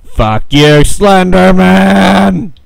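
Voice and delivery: loud, slowly stretched yell; real rage, yelled high and slow; furious shout. Real, not acted. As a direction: shout him down with a drawn-out curse. Cursing the enemy.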